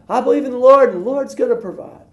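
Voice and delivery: very high put-on smug voice; smug faith. Man on his roof telling the helicopter crew, very high smug voice. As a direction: chirp it with full confidence.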